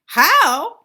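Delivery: loud crowing cackle; cackling crow. A narrator's reading. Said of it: "cackled the crows".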